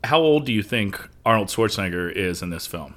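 normal talking voice

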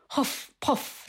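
breathy huff-puff like blowing bellows. Shown as a demonstration. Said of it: "so I'll read it in Middle English first".

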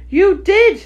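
high, loud retort; heated contradiction. Acted. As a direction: fire it back in a squabble. Hatter argues back with March Hare.

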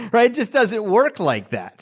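laughing. Real, not acted. Pastor laughing at scheduling interruptions, laughing. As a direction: say it while laughing at the idea.

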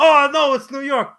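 high, loud mock alarm; hammed-up cry. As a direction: shout it like a cartoon hero. Mock hero exclaiming his name.

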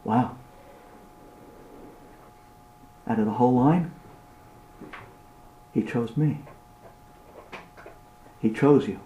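low, soft, with long pauses; reverent, softly spoken realisation; hushed awe. Acted. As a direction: say it quietly in solemn wonder. Awe at being chosen.

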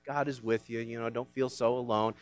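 mock sweet comfort. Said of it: "not just something to make you feel better".

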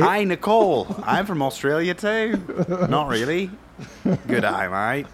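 playful faint Australian twang; mock Australian accent. Talking to Nicole on a first date, mild Australian accent. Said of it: "You like my Australian accent?".

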